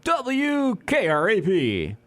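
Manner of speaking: slow, letter by letter announcer read; slow spelled out. Acted. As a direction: spell it out slowly on air.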